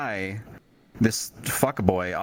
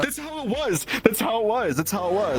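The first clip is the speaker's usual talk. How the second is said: high, squealing through laughter; laughing hard; 11 semitones higher than usual. Real, not acted. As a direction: crack up while confirming the impression. Cracking up at friend's impression.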